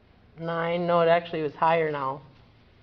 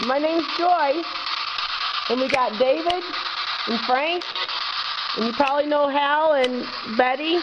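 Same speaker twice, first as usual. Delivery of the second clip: high, raised voice calling to spirits; EVP spirit call-out; 8 semitones higher than usual. Acted. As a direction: call out gently into the dark room.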